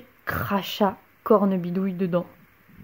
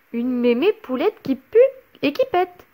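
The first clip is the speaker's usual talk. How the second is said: put-on cheeky boy, louder on first words; taunting; 6 semitones higher, 3 dB louder than usual. A narrator's reading. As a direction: jeer at the trapped witch. Pierre taunts.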